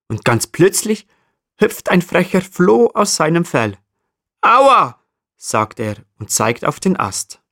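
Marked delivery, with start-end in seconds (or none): 4.4-5.0 squeaky high ouch, louder; high yelp of pain. Acted. The flea yelps at the marmot, high squeaky ouch.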